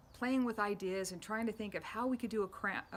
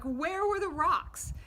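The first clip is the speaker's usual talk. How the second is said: higher, louder and slowed, amazed at the news; excited disbelief; 9 semitones higher, 7 dB louder, slower than usual. Retold or imitated. Asking Brett where the lost rocks turned up, amazed and louder. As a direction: stretch it out in wide-eyed surprise. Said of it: "And I'm like".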